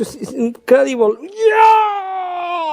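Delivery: excited cheer. Real, not acted. Trick climax, cheering.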